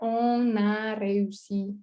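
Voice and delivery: "on a réussi" drawn out syllable by syllable; slow French "on a réussi". Shown as a demonstration. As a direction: spell out "on a réussi" very slowly, stress on "on". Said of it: "kasih cara bacanya". ("on a réussi" diucapkan pelan sekali per suku kata)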